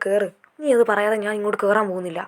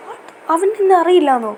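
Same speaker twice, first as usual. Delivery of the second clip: shocked disbelief; 7 semitones higher, 5 dB louder than usual. Acted. Friend doesn't recognise him.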